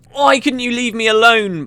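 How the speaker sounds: high, falling moan. Acted. Half-conscious engineer to the man robbing her, moaning. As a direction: whine it in half-conscious annoyance. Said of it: "Poisey moaned".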